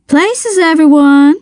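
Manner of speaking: high, drawn-out summons; calling out. A narrator's reading. Lana rallying the café for opening, high sing-out call. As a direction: call the staff to their positions.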